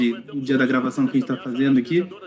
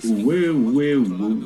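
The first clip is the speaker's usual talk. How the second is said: robot voice; 4 semitones lower, much slower than usual. Retold or imitated. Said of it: "dá esse efeito robótico na voz".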